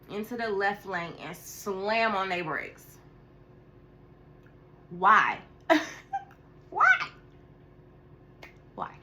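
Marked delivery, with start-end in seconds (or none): 4.9-8.9 exasperated why. Real, not acted. To the car that braked ahead, loud disbelief. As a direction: throw up your hands at the driver.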